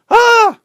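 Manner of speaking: high shrieked Ah; a hammed-up panicked shriek; loud high mock yelp of panic; mock scream. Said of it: "They run outside".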